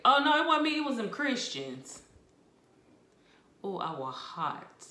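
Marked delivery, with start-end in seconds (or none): 0.0-2.0 high, loud mock protest of innocence; Nero blaming others. Emperor Nero shifting blame onto Christians, high and loud. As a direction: play Nero pointing the finger, high and loud. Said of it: "and he was like".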